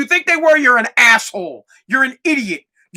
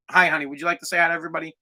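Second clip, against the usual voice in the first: soft aside to daughter; 6 semitones lower, 6 dB quieter, much faster than usual. Real, not acted. Daughter walks into recording.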